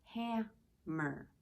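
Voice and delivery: "Hammer" in two separated beats; split into syllables. Shown as a demonstration. Teacher showing children how to count syllables, "Hammer" broken in two. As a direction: say "Hammer" as ham, pause, mer. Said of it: "That was a two syllable".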